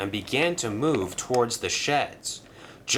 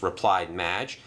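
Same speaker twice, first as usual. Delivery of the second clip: plain reading voice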